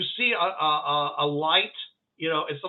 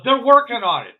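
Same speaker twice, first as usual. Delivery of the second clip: loud, higher call; raised voice passing news along; rescuer relaying word; 7 semitones higher, 10 dB louder than usual. Acted. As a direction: call it out to reassure them. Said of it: "he says, uh".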